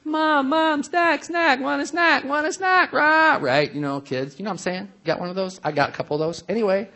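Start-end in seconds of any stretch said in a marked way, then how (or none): 0.0-3.4 put-on begging kid. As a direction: shout it like a child pestering mom. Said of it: "the kid's like".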